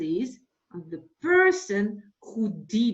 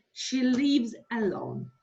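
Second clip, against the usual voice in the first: normal talking voice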